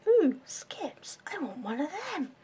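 high breathy whisper of sudden craving. Retold or imitated. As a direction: whisper it like you crave a snack. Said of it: "it's like".

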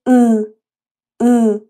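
ا said through the nose as the Turkish letter ı; nasal Turkish letter. Shown as a demonstration. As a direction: voice ا nasally, loud and clear. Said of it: "باید از طریق بینی تلفظ بشه". (ا را از بینی تلفظ کن)

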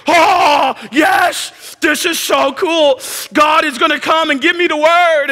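high, thrilled and slow; like a kid sure the big moment is here; excited. Retold or imitated. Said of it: "Then the earthquake".

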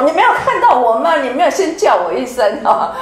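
hammed-up hurt dad demanding a greeting; father mock-scolding the child who ignored him; mock angry dad. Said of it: "老爸很生气说".

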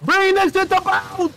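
loud, high shout across the deck; high frantic yell. Real, not acted. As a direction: yell it over the ship's noise. Shouting across ship in game.

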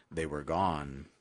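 ordinary delivery, nothing marked

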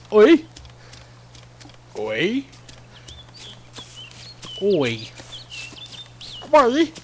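shouted British Oi, tried several ways; British accent attempt. Shown as a demonstration. Gamer testing a British accent, clumsy British Oi. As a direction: try out a British Oi, loud then questioning. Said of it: "see if I can get a British voice".

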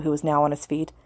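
ordinary delivery, nothing marked

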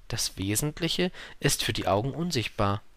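learner's simple echo; little prince repeating. Acted. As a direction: repeat it to yourself, unemphatic. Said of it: "wiederholte der kleine Prinz, um es sich zu merken".

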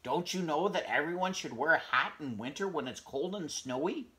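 high, loud indignant hedgehog; angry shout. Acted. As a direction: shout back, fed up. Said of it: "Hedgie shouted".

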